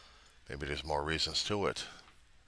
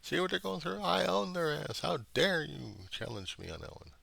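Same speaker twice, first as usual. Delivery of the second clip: much higher, taunting sneer; high mocking gloat; gloating mocker; 13 semitones higher than usual. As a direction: play the cruel tormentor crowing over his victims. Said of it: "someone else laughing their ass off saying".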